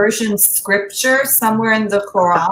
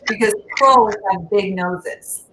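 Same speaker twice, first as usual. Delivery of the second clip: normal talking voice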